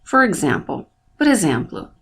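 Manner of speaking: normal talking voice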